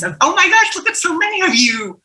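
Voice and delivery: high-pitched delighted astonishment; real amazement. Real, not acted. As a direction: gush in surprise, voice leaping up high.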